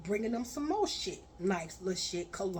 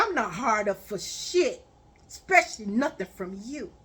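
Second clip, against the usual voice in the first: louder and faster, scolding; angry rant; 5 dB louder than usual. Retold or imitated. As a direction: tear into him, raising your voice. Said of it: "I said".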